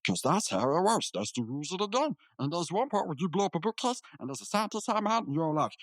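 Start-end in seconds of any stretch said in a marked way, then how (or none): none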